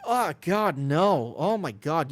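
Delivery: high, loud disgusted gasp of horror; hammed-up grossed-out cry; mock disgust. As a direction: recoil from the bowl in mock revulsion. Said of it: "you're like, like".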